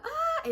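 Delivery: high-pitched yelp; acted short scream. Storyteller squatting down at gunfire, high mock scream. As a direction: let out a quick mock scream. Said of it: "start screaming".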